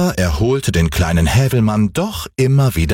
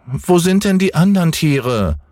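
put-on high little boy's questioning call; Häwelmann calling up; 8 semitones higher than usual. A narrator's reading. Boy asking the cat about the animals, high childlike call. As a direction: ask up high like a small child. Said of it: "rief der kleine Häwelmann hinauf".

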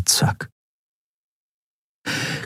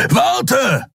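calling out. A narrator's reading. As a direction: yell after him to stop. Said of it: "rief Kero dem Menschen hinterher".

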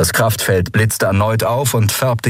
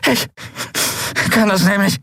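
high, halting, effortful voice; pained, high-pitched bravado in broken phrases; strained defiance; 12 semitones higher than usual. A narrator's reading. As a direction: taunt them between gasps of pain. Tortured singer defies her captors.

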